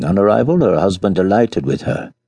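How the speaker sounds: ordinary delivery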